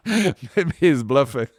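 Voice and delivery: slow words through real laughter; laughing. Real, not acted. Telling the host about the job offer, laughing. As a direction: chuckle it out as you say it. Laughing at his own gamble.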